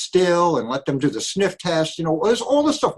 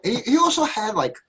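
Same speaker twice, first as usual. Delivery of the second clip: ordinary delivery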